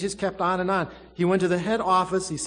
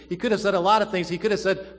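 usual speaking voice

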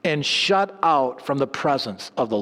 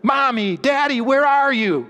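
higher, mock screaming child calling out; 8 semitones higher than usual. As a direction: retell the boy's cry from the window, pitched up high. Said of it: "screaming out the window".

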